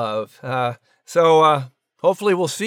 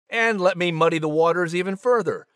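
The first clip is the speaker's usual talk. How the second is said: ordinary talk, nothing marked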